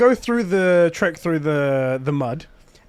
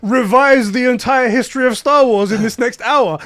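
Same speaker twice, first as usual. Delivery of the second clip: louder, higher outburst of alarm; panicked disbelief; 7 semitones higher, 7 dB louder than usual. Retold or imitated. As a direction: retell a panic, loud and high. Acting out his panicked reaction.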